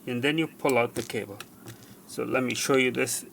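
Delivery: ordinary talk, nothing marked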